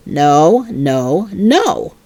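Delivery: low, firm scolding mother voice; stern mother. Acted. Mother refusing Junie B's bedtime wish, low and stern. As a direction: put your foot down like a strict mom.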